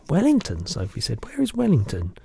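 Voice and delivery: put-on a curious little girl protesting; Sophie's voice.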